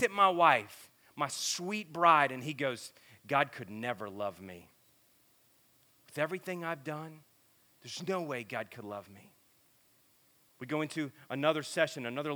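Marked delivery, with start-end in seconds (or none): 3.3-9.3 low, soft, defeated; quiet despair, understated; retold hopeless confession. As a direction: drop the voice low, as if unlovable. Retells a man's hopeless confession.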